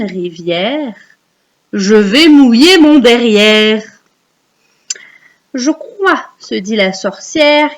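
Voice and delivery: put-on slow, measured recital for young children; poem recitation. Shown as a demonstration. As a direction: recite with drawn-out line endings and clear pauses. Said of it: "je vous le récite".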